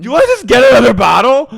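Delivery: high, loud, fast tipsy shout; drunk Miranda. Retold or imitated. Miranda demanding another bottle, fast tipsy shout. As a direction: blurt it out like she is already drunk. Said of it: "Charlotte goes".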